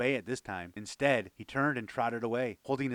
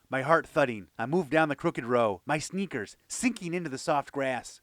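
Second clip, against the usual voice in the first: usual speaking voice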